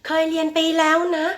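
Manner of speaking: high, level protest in a pupil's voice; puzzled pupil. Acted. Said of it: "บางคนงง".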